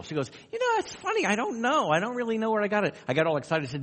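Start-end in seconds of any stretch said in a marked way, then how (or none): 0.5-3.0 put-on much higher, quicker voice for the young student; voicing the student. Interviewer retelling a student's puzzled answer, in a high voice. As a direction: play the puzzled college girl, light and high.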